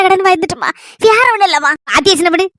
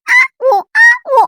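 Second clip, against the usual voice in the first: high mumbled ah oh noises; high mute grunts for granny; wordless sounds. Retold or imitated. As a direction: mime answering with only sounds. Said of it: "ஒரு சத்தத்தை மட்டும் கொடுத்துட்டு".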